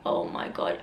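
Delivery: soft whisper of disbelief; hushed, voiceless re-enacted shock; stunned whisper. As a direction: whisper it in shock, almost to yourself. Re-enacting stunned moment against door.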